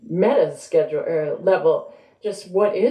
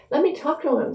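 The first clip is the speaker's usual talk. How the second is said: eager husband; 10 semitones higher than usual. A narrator's reading. Haru's dialogue from another room.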